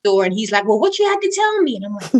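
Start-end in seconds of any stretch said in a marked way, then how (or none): 0.7-1.7 high-pitched imitation of a nagging husband; impatient husband. Husband demands the news at the door, high impatient imitation. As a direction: pounce with the question, pitched high.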